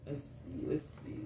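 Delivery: soft, low murmur; quiet let's see under her breath; whispering to herself. Real, not acted. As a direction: whisper it while concentrating. Peering at herself on camera.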